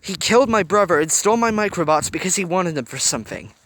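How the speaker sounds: urgent plea. A narrator's reading. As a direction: make her see the danger. Hiro pleading urgently.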